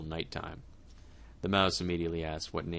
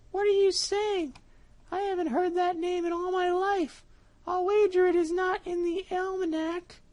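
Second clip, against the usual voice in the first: a trusting mouse sending him off; little mouse; 7 dB louder than usual. Acted. Mouse astonished.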